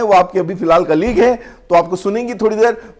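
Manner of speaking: ordinary talk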